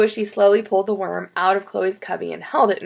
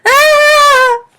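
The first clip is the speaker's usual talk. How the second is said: high, piercing loud scream; full-out shriek of fright; 13 dB louder than usual. A narrator's reading. Chloe finds a worm in her cubby, screaming. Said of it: "running around the room screaming".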